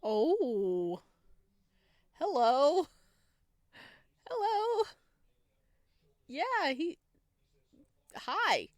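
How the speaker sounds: high and flustered; flustered greeting. Real, not acted. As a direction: squeak out a nervous hello. Flustered reaction to romance.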